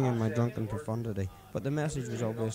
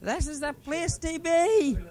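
slow, much higher and louder yell; screaming; 7 dB louder, slower than usual. Acted. Drunk friends screaming into the blasting night air, loud yell. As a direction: scream it out the window. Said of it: "we screamed".

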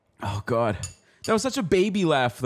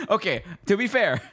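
normal talking voice

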